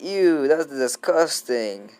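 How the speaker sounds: slow, drawn-out grossed-out groan; disgusted. Acted. Boy told about grown-up stuff, slow grossed-out disgust. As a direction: pull a face and stretch out the disgust. Gaara hears about the club.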